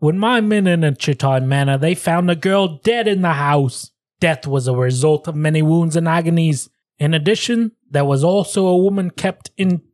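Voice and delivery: put-on amateur British accent sliding into Australian; wobbly British-Australian accent quote; British accent drifting Australian. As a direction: attempt British, land near Australian. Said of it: "I think I'm feeling Australian".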